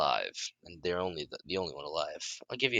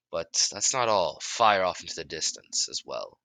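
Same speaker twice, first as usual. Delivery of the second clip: ordinary delivery, nothing marked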